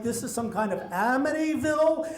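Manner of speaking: replaying his own frantic thoughts, hammed up with a laugh; a comic spiral of dread about the house; retold panic. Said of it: "I'm totally panicked".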